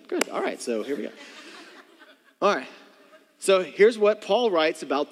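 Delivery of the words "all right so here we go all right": ordinary delivery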